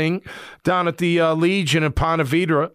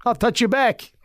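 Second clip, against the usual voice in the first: movie character; 5 semitones higher than usual. Retold or imitated. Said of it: "the character's like".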